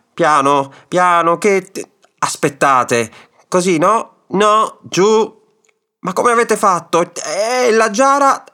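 high, agitated cries of alarm; shouting. A narrator's reading. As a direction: fret loudly, pitch climbing as you go. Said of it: "gridò al vecchio". (grida agitate, sempre più acute)

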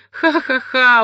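high, loud, jeering ha-ha-ha; a loud taunting cackle; mocking laugh. A narrator's reading. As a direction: laugh at him, loud and gleeful. Daughter laughs at narrator.

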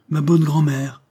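high, loud cry of joy; exclaiming. A narrator's reading. The girl cries out, grateful to her grandmother. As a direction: cry it out with childlike gratitude. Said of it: "s'écria Angèle".

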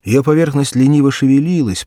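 ordinary delivery

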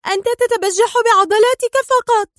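very high, loud wail of protest; near-tearful scream. A narrator's reading. Sonia screaming at the man overpowering her, near-tearful. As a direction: cry out as if close to tears. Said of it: "صرخت سونيا في صوت أقرب إلى البكاء".

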